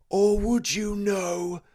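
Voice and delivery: high, loud, slow mournful spirit voice; wailing ghost. A narrator's reading. The ghost lamenting its fate to Scrooge, high and wailing. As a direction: moan it like a doomed soul.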